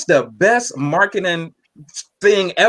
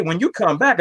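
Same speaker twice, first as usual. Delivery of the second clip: usual speaking voice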